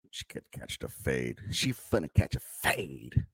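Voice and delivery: soft, slow Valley girl imitation; mock Valley girl. Host mocking her threat to catch the fade, slow Valley girl imitation. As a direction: stretch it out quietly like a mocked Valley girl. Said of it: "Talking like a fucking Valley girl".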